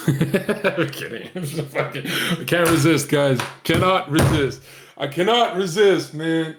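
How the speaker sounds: high, giggly, owning up to a joke; real laughter spilling into the words; laughing confession. Real, not acted. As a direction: crack up, helpless to stop joking. Laughing at his own dark joke.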